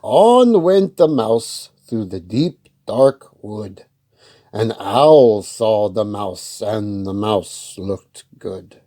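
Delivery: put-on slow, loud read-aloud voice for kids; story narration. A narrator's reading. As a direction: tell it slowly and clearly to children.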